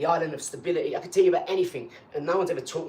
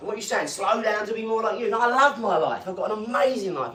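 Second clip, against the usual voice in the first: indignant rant; 6 semitones higher, 4 dB louder than usual. Real, not acted. Continuing rant at critics.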